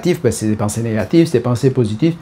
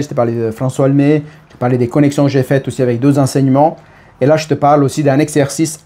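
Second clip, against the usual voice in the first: ordinary delivery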